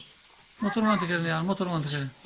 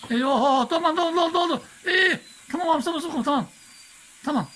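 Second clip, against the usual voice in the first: excited exclaiming; 8 semitones higher than usual. Real, not acted. Bucket filling, father exclaims.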